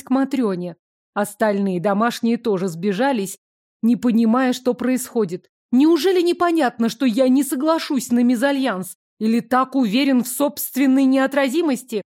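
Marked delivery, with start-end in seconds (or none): 5.7-12.0 indignant. Acted. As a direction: demand it, hands on hips. Heroine fumes, hands on hips.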